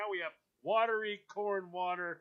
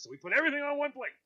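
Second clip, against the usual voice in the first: higher, louder, fast; mock family voice; 10 semitones higher, 5 dB louder, much faster than usual. Host voicing Caroline's family, mock rushed. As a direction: rattle it off like her family.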